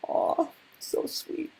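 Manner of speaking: soft breathy aside between verses; whispered sweet aside. Acted. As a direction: murmur it to the crowd. Aside during song.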